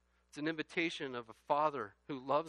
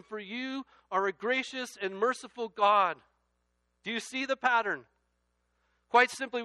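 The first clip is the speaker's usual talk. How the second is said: loud, high scripture reading; preaching at full force; 8 semitones higher, 11 dB louder than usual. Acted. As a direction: proclaim the verse to the back row.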